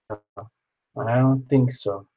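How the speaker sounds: stretched way out, slowly; slow drawn-out. Acted. Checking the list, said very slowly. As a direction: drag out each word.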